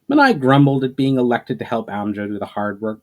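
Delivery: plain reading voice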